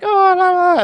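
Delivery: high-pitched mock swoon; mock swooning crowd. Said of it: "everyone was like".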